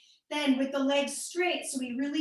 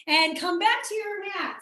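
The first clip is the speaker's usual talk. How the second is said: strong Midwestern accent, pitched up; higher-pitched call in a Midwestern accent; 5 semitones higher, 4 dB louder than usual. Real, not acted. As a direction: let the Midwestern accent come out hard. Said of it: "that was my Midwestern accent coming out very hard".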